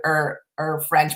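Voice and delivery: usual speaking voice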